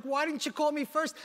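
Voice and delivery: mad wife, imitated. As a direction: mimic an offended wife demanding an answer. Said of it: "She was so mad".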